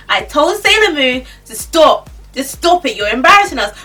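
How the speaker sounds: scolding. Retold or imitated. Scolding Sailor Moon in public.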